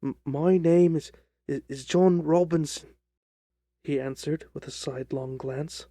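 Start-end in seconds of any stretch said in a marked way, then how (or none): 0.0-2.9 nervously stumbling over a false name; hesitant stammer. Acted. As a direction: stammer evasively as the little man. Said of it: "The man hesitated for an instant".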